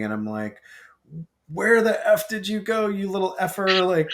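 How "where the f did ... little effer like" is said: high, loud mock scream of outrage; retold screaming. Teenager screaming at little brother who wrecked his room, re-enacted. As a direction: re-enact an exasperated yell at a kid. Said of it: "like, screaming".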